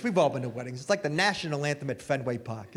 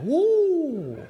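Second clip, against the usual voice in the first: whoop; 11 semitones higher, 4 dB louder than usual. Retold or imitated. Mock wife cheering.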